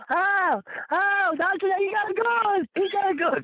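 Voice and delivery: high-pitched hysterical female holler; panicked hollering woman. Retold or imitated. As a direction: holler it out like a terrified lady who just spotted a gun. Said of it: "She started hollering".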